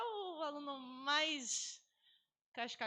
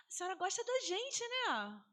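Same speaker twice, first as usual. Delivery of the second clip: higher, pleading pupil voice; pupils asking; 8 semitones higher than usual. Retold or imitated. Teacher imitating pupils asking if she likes them, higher and hopeful. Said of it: "Eu, eu escutei isso".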